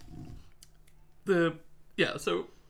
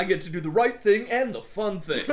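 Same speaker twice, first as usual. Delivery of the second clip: higher, louder, laughing delight; laughing glee; 4 semitones higher, 6 dB louder than usual. Pretend. Archibald revels in the chance, laughing glee. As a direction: gloat happily. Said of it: "he was like".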